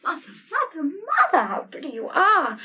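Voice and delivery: high, thrilled, delighted cry; ecstatic admiration. Acted. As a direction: gush over her with delight. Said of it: "in an ecstasy of admiration".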